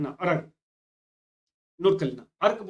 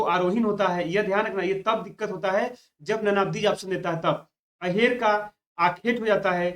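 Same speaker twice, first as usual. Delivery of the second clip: ordinary delivery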